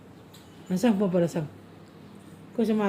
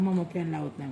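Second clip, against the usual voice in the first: put-on softer, lower words for the child to repeat; coaxing prompt; 5 semitones lower, 6 dB quieter than usual. Shown as a demonstration. Mother feeds toddler a complaint for papa, softly coaxing. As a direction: gently model the sentence. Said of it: "म्हणावं".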